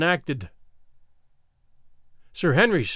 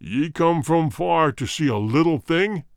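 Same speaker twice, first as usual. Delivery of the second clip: warrior king. Acted. King answers.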